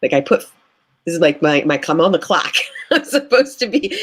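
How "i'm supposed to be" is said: laughing it out, high and light; giggling as you repeat your own guilty thought. Retold or imitated. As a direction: say it through a laugh, amused at yourself. Repeats the line through a laugh.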